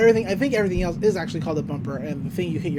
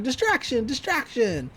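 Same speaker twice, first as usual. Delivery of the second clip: retold shout; 6 semitones higher than usual. Mabel distracting the cowboy, high half-voiced mock shout. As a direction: imitate shouting on a raised pitch. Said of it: "And Mabel's even shouting".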